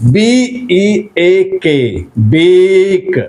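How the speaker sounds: teacher spelling B E A K then saying bake; model pronunciation. Shown as a demonstration. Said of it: "listen to me very attentively".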